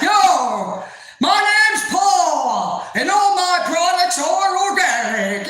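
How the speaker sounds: high, loud, stretched-out holler; mimicked screaming boss. Boss introducing himself and his products, hollering. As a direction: holler it slowly like a blaring self-promoter. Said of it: "screams at the top of his lungs".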